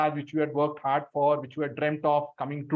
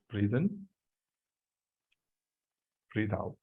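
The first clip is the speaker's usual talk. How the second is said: soft, hushed breathing cues; quiet meditation guide; guided breathing; 4 semitones lower, 11 dB quieter than usual. Acted. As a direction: ease them through each breath, softly.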